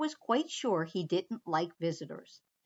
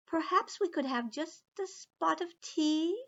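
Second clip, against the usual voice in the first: higher, polite mouse voice; polite little mouse; 7 semitones higher, 3 dB quieter than usual. Acted. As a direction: ask sweetly like a tiny guest. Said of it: "said the mouse".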